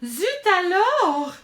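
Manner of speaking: high, loud, drawn-out exclamation; stretched, theatrical French outburst; mock French exclamation. A narrator's reading. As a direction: cry it out slowly in dramatic alarm. Excited narrator exclaims French phrase.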